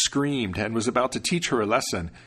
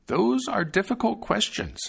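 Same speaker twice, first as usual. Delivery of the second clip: protective grandmother, unhurried; old grandmother; 11 semitones higher than usual. Acted. Grandmother answers hero.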